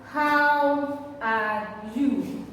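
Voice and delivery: very slow, drawn-out question; teacher slowly modelling a question, word by word; question demo. Shown as a demonstration. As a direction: stretch each word of the question right out. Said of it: "This is how to ask a question".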